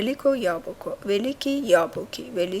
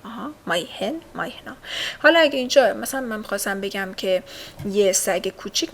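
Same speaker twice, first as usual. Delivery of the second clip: usual speaking voice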